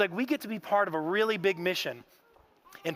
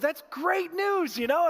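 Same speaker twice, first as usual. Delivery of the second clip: stunned delight; 11 semitones higher, slower than usual. Retold or imitated. Triplets dad reacts to news.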